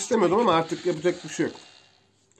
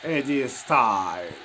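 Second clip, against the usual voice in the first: announcer-style call; 6 semitones lower, 3 dB quieter, much slower than usual. Pretend. Intones opponent's name during match.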